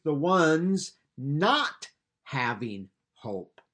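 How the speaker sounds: slow, weighty stress on "not". Shown as a demonstration. Preacher pointing out the Greek wording, "not" heavily stressed. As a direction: set "not" apart and lean on it, drawing the phrase out. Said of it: "There's an emphasis in there".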